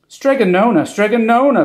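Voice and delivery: high breathless calls; breathless high calling; out of breath calling. A narrator's reading. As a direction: call her name, panting from the run. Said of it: "called out of breath".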